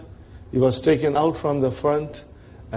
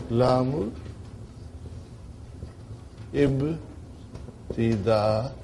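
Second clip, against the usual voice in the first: slow dictation; 2 semitones lower, 7 dB quieter, much slower than usual. Shown as a demonstration. Dictating the term slowly to class.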